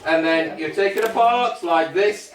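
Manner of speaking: normal talking voice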